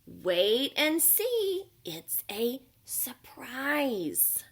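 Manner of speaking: slow, then dropping to a whisper on the surprise; secretive panda. Acted. Panda teases the bunny, slow then whispered. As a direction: keep the secret, slow and hushed.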